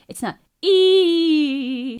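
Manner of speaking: slow, loud glottal attack on E; hard glottal E. Shown as a demonstration. Vocal coach contrasting a glottal onset. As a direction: seal the folds then pop the E. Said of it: "like an E".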